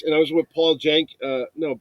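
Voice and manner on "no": usual speaking voice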